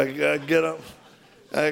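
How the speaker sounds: ordinary delivery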